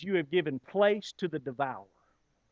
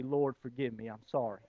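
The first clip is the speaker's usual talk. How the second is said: much lower and softer humble plea; soft confession; 8 semitones lower, 9 dB quieter than usual. Shown as a demonstration. Preacher modelling a prayer of repentance, soft and humble. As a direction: say it softly, bowed in repentance. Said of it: "to say".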